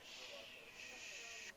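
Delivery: unvoiced shush to the class; teacher's shush to settle students. Real, not acted. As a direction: hush the room with a breathy shh. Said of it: "Quiet down now".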